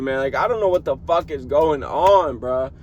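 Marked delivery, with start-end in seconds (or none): none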